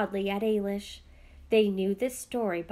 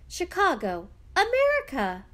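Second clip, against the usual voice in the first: America yelped much higher and louder; excited yelp; 9 semitones higher, 6 dB louder than usual. A narrator's reading. Young daughter yelping at news of Chicago, America. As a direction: burst out with America in delight. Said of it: "Fiona yelped".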